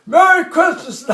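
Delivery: high, loud holiday call; colonel's shouted greeting. Retold or imitated. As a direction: call it out to the whole troop. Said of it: "We all yell back".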